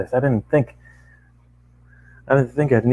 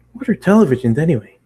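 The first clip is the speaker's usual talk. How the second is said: curious pony; 6 semitones higher, 4 dB louder, faster than usual. Acted. Pony asks, voiced higher.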